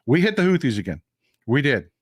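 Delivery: normal talking voice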